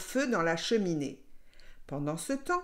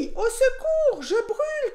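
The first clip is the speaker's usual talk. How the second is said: very high, loud panicked yell; shrieking burnt wolf; cries of pain; 5 dB louder than usual. A narrator's reading. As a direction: scream for help as if burning. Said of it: "cria-t-il".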